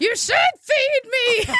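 high, slow wailing whine; whiny demand. Pretend. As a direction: demand food like a toddler.

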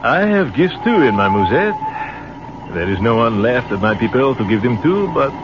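put-on French captain with no family left, in a French accent. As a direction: let it fall away sadly, French accent.

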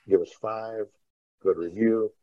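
ordinary talk

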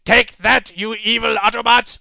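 loud villain battle cry; cartoon villain. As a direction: shout it like a cartoon baddie attacking. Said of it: "Transformers cartoon".

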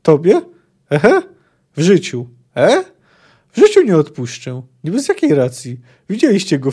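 loud, high, indignant disbelief; astonished refusal. Acted. Condemned poet refuses to forgive his executioner, astonished and indignant. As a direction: protest in incredulous swooping questions. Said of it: "zdziwił się Jaskier".

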